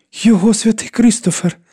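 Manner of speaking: високий схвильований вигук; ахнути, впізнавши втрачену річ; радісне здивування. A narrator's reading. Карла впізнає втрачений медальйон. (схвильовано й високо)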